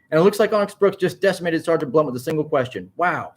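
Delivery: high, excited live commentary; exclaiming. A narrator's reading. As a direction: gush like an amazed sportscaster. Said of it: "Sammy exclaimed".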